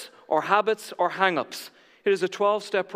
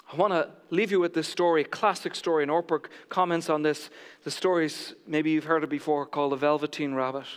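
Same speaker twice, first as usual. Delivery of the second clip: ordinary talk, nothing marked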